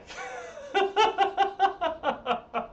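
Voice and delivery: high-pitched rapid cackle; high laugh. Real, not acted. Streamer cracking up at looking like that guy, high laugh. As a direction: laugh out in a long high staccato run. Laughs after own joke.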